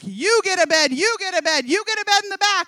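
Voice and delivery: booming, hammed-up talk-show excitement; Oprah. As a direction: hand out prizes to a screaming audience. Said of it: "We're all Oprah over there".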